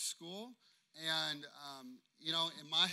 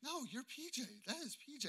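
baffled listeners; 8 semitones higher, 7 dB quieter than usual. Retold or imitated. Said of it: "They're like".

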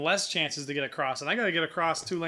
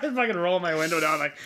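ordinary talk

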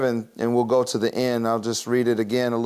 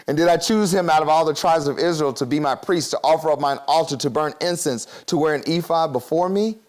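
measured pulpit reading of the verse; solemn Bible reading; scripture reading; 3 semitones higher than usual. Acted. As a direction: read each virtue out as a list. Reads scripture aloud.

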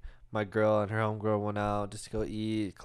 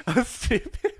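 real laughter; 12 semitones higher than usual. Real, not acted. Still laughing at himself.

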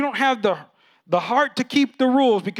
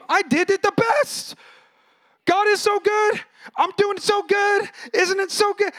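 high, slow, self-satisfied sing-song; smug rule-keeper; 13 semitones higher, 3 dB louder, slower than usual. Retold or imitated. Preacher mocks believers who credit themselves, high smug voice. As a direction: brag like a smug child, much higher. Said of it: "you credit your rule keeping".

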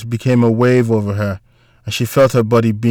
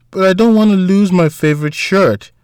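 put-on child's complaint in a higher pitch; sniffly protest; 8 semitones higher than usual. A narrator's reading. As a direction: plead like an upset little boy.

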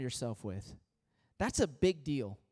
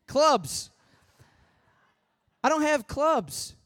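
corrected word, much higher and louder; self-correction; 10 semitones higher, 6 dB louder, slower than usual. Retold or imitated. Pastor corrects his slip about golf clubs, emphatic. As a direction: fix the slip with emphasis, slowly. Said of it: "Or clothes".

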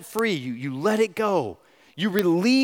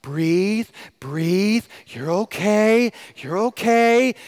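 coaxing stranger; 4 semitones higher, 4 dB louder, much slower than usual. Retold or imitated. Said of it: "this lady's like".

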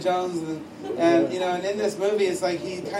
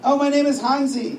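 higher friendly stranger's voice; lightly acted, raised voice; lightly voiced Hansi; 6 semitones higher, 4 dB louder than usual. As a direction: introduce yourself as a helpful Swiss stranger. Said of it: "And the voice says".

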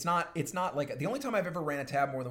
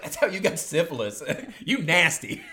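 louder, laughing through the sassy quote; 6 semitones higher, 7 dB louder than usual. Retold or imitated. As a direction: crack up while saying you nasty. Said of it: "she's like".

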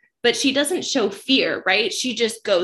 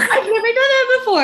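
put-on much higher, louder, awestruck boy voice; giddy Callum; 8 dB louder than usual. Cohost echoing Callum on the boat, awestruck and high. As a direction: squeak it out in amazement. Quoting Callum after the swing.

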